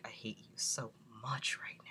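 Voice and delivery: soft hissing whisper of mock hatred; whispered grudge. Muttering at the nurse about the ibuprofen, whispered. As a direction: breathe it like a secret curse.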